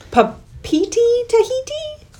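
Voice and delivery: very high, uncertain squeak on Papeete and Tahiti. Real, not acted. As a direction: lift the voice way up, unsure of the word. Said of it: "See how her voice got higher?".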